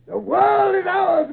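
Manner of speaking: slow, high, loud rallying shout; rally the crew with a roar. Acted. Captain rallies his men.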